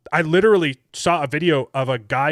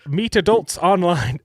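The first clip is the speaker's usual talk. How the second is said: put-on slow, higher Indian accent; 4 semitones higher, slower than usual. Reading a dating ad aloud, Indian accent. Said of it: "I switched to an Indian accent".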